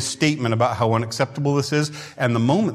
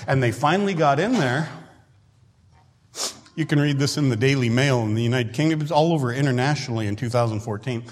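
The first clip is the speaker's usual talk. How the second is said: usual speaking voice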